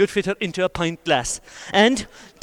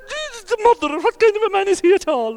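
much higher, shocked outcry; horrified crowd. Acted. Crowd watching the son beat his mother: high shocked outcry. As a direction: cry out in appalled disbelief.

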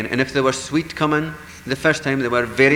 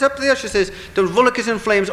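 alarmed cry; 9 semitones higher, 3 dB louder than usual. Acted. Wife points out the fire.

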